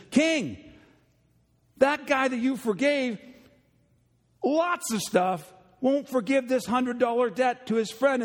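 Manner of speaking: high, urgent tattling servant. Retold or imitated. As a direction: rush to tell the king. Servant reports to king.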